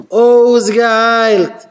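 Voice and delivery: loud, drawn out, falling cry; emphatic shout. Real, not acted. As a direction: hammer home the healing. Preacher's climax. (א הויכער געשריי)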